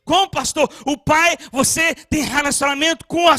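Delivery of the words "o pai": ordinary delivery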